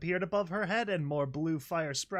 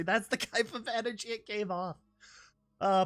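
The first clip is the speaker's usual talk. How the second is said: ordinary talk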